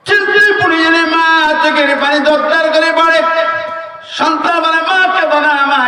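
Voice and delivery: high, ringing sermon delivery; preaching at full force. Acted. Preacher raising his voice to the crowd, fiery sermon.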